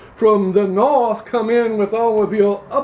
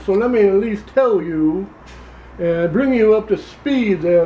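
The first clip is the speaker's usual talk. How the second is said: put-on Louisiana accent; 2 semitones lower than usual. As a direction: play a pompous Louisiana candidate, Southern accent. Same character continues.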